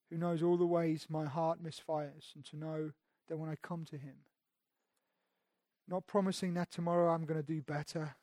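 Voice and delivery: ordinary talk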